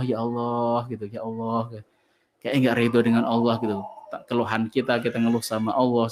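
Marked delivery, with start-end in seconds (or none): 0.0-1.9 lightly imitated complaining moan, fading softer; mock discontented sigh. Preacher imitates a discontented believer, understated moan. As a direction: sigh at God as if unwilling.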